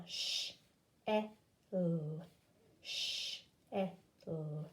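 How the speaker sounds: "Sh, e, l" each sound alone. Shown as a demonstration. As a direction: tap out each sound separately. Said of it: "Do it together".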